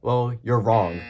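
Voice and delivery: ordinary delivery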